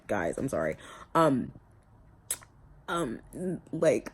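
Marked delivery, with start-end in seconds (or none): none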